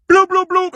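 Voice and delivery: high, loud "bloop, bloop, bloop"; imitated tumbling noise. Vlogger mimicking Michelle's onstage fall, comic noises.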